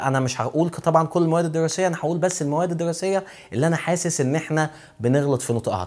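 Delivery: ordinary talk, nothing marked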